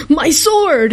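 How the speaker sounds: high-pitched video game cry; typical Nintendo voice. Retold or imitated. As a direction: imitate a cheesy game sound bite yell. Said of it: "he yells it in this very typical Nintendo voice".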